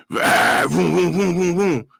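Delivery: a quick car noise for the story; imitated car engine. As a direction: mimic the cop car racing up. Detectives' car pulling up.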